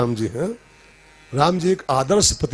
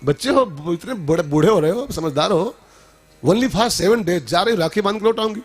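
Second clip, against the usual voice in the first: higher, scolding wife voice, half amused; 6 semitones higher than usual. Acted. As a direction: brush off his tears like a bossy wife. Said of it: "तो उसने कहा". (पत्नी की ऊँची डाँटती आवाज़)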